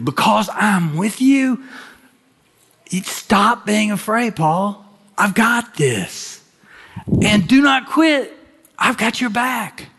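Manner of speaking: high, rising preacher's promise; fervent reassurance. Acted. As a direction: lift it up and reassure. Voicing God reassuring Paul.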